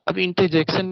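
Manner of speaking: normal talking voice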